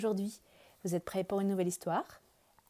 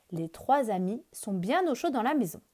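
usual speaking voice

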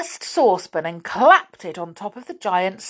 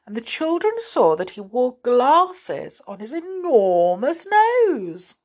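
plain reading voice

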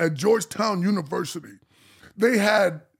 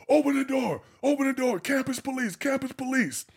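police shouting at door; 8 semitones higher than usual. Retold or imitated. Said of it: "They all shouting through the door".

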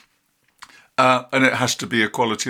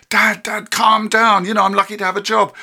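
high, slightly louder mock daughter pleading; imitating daughter; 9 semitones higher, 6 dB louder than usual. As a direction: play the grown kid soothing her ranting dad, pitched high. Daughter quoted telling dad calm.

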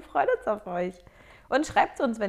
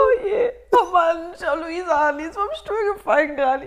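high laughter through tears; laughing and crying; 8 semitones higher, 7 dB louder than usual. Real, not acted. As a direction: gasp the words out laughing. Laughing fit, joking about falling off chair.

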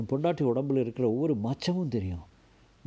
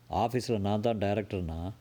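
plain reading voice

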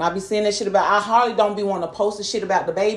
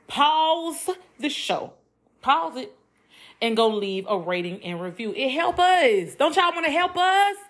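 higher, coaxing sales pitch; playful promo plea; 9 semitones higher than usual. Acted. Host begging listeners for ratings, coaxing promo voice. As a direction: cajole the listeners like a host's plug. Mid-show plug for reviews.

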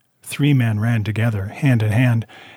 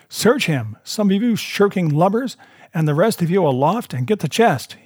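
raised-pitch command to a crew; cried orders; 7 semitones higher than usual. A narrator's reading. Blind man swears at his men for their delay, cried orders. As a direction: call out impatient orders, pitched up. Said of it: "he cried".

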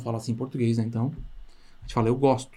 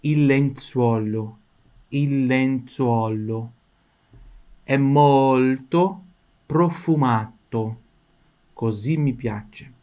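very slow demo; much slower than usual. Shown as a demonstration. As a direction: savor the sentence slowly. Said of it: "Repita em voz alta". (frase inteira bem devagar, saboreando)

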